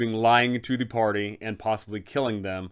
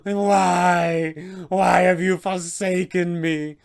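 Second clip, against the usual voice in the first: put-on higher, slower, despairing young man's cry to his father; anguished vampire spawn; 6 semitones higher, 4 dB louder, much slower than usual. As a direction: call out to the father who abandoned him, pained and slow. Doru's anguished plea, held figure.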